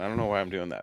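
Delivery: usual speaking voice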